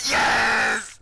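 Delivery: ecstatic high yell and laugh; cheering in relief; triumphant shout. Real, not acted. Win after close fight.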